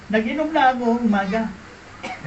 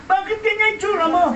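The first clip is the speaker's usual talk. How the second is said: shocked question; 8 semitones higher than usual. Retold or imitated. Asking friend, shocked.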